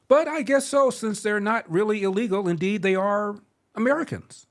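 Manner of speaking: high, mocking mock-agreement; sarcastic. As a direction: sneer it out as if conceding.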